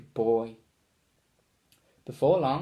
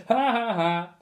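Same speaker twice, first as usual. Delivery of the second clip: high jeering ha-ha; mocking kids' laughter; 6 semitones higher than usual. A narrator's reading. Kids laugh at the pink costumes, jeering. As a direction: laugh it at them. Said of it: "the kids burst into laughter".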